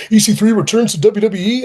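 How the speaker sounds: loud, high hype announcer; mock clickbait headline. Wrestler mocking social media promotion, hyped false headline. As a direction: blare it like a sensational false headline. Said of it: "you gotta put the false headlines".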